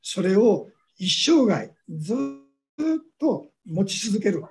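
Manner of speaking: ordinary talk, nothing marked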